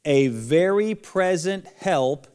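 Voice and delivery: slow and loud, every word drawn out; teacher calling out a memory verse for kids to repeat; slow lead-along recitation. Shown as a demonstration. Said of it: "Everybody again, following the marker".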